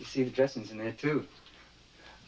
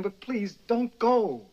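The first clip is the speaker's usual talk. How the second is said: higher, slower, begging harder; a husband's last desperate plea to his wife; urgent plea; 7 semitones higher, slower than usual. Acted. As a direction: plead as if she is already leaving. Husband begs again, rising.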